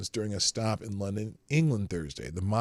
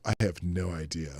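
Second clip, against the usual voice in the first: ordinary delivery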